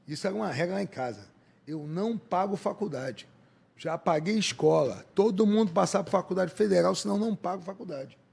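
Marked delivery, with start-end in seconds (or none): 1.7-8.2 bang the rule down like a strict father; stern father's rule. Retold or imitated. Father laying down the house rule.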